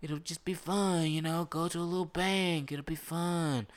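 a younger McClane brushing off the job; breezy high voice. Retold or imitated. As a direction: make it sound like a lighthearted outing. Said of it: "in the first one he's like".

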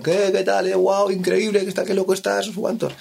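high, buzzing hype-man voice; excited stage greeting. Retold or imitated. Cristian hypes him up on stage, high and excited. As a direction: greet the crowd all fired up.

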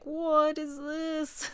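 slow, high disgusted question; grossed-out taster. Retold or imitated. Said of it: "you're like".

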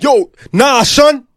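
loud, high, disbelieving holler; street yelling. Retold or imitated. Imitating guys outside on a Harlem street, loud disbelieving yell. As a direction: imitate a guy hollering shocking news across the block.

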